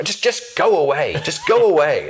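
an irritated boss waving him away; exasperated dismissal. Retold or imitated.

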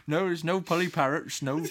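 put-on Cornish accent. Teacher missing the pupils' parrot joke, mimicked Cornish accent. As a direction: put on a West Country accent, amused. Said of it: "he was from Cornwall or that kind of area".

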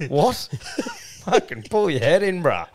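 high-pitched disbelief breaking into laughter; laughing mock outrage. Podcaster imagines rebuking someone ordering a pie, laughing mock outrage.

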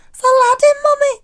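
put-on very high little-child voice; imitated two-year-old. Niece shouts to her mother at station, high toddler voice. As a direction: pipe it up like a toddler calling to mum. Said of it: "in a two-year-old's accent".

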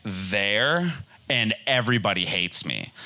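normal talking voice

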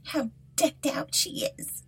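high, mocking voice sliding to a sneering whisper; jeering stepsisters. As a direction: tease her like a spiteful sister. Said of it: "they cried and laughed".

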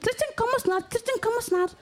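high sing-song mock Iki; high parroting mock voice; mimicking Iki. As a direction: squeak it out like a stuck record. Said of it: "og sagde sådan".

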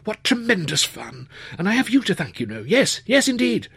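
high, bubbling delight; elated. A narrator's reading. Thrilled actor thanks his helper in the wings, delighted. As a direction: gush your thanks.

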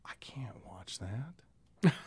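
hushed, slow whisper. Real, not acted. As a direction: whisper it, stricken. Upset about Carl dying.

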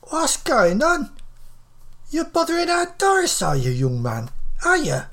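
put-on high-pitched elderly northern woman, suspicious; accusing old Beryl. Elderly friend asks if he is bothering Doris, accusing. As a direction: challenge him in a high, scolding voice. Plays Beryl, confronting the man.

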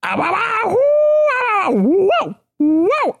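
put-on a delighted old coot gasping wow after wow; old man's wows. Old man marvels, leaving.

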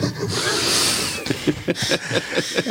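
breathy burst of laughter. Real, not acted. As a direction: laugh at your own joke. Laughs after joke about film.

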